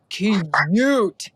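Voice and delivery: "cute" held long, in two beats; drawn-out emphasis. Real, not acted. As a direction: stretch out "cute" playfully. Stretches word while plugging clothes.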